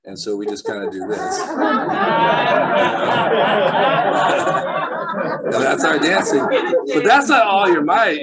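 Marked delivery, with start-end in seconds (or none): 1.4-5.1 loud, high burst of laughter; big laugh. Real, not acted. Congregation hears about his dance moves, loud high laughter. 5.2-8.2 talking through laughter, louder and higher; laughing. Real, not acted. Joking to the church about his dancing, laughing.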